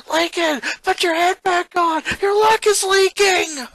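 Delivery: high, loud, panicked shout; urgent yell. A narrator's reading. Lana warning Lincoln mid-gator fight, frantic yell. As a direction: scream the warning across the arena.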